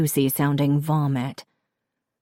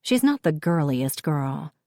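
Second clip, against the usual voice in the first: plain reading voice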